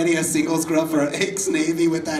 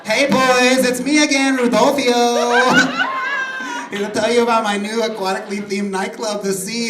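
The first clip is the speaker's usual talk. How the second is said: high, hammed-up Mexican accent; put-on Mexican accent; 5 semitones higher than usual. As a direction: greet the boys in a higher, mock-Mexican accent. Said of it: "I don't know why I'm Mexican all of a sudden".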